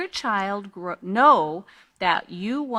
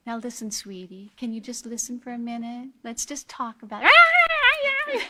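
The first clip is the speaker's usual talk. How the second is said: mock sweet parent; 3 semitones higher, 4 dB louder than usual. Parent soothing an angry child.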